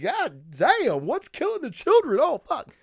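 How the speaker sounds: high-pitched, hammed-up shock; mock alarm. Reviewer acting out his chill at a book title, mock alarm. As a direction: react with comic dread, voice jumping up high.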